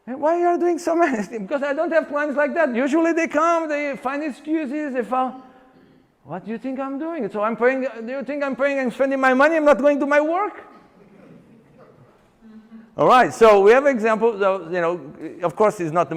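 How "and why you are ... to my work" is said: high, lively indignant retort; indignant client. Retold or imitated. Client tells trainer why she trains hard, high and indignant. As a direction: client defends her effort, much higher. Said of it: "client answers trainer".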